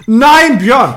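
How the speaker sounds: high loud disbelieving shout; shocked outcry. Real, not acted. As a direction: cry out in shock. Reacting to the other's burp.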